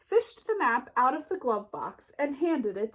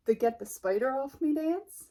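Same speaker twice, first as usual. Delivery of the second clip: ordinary delivery, nothing marked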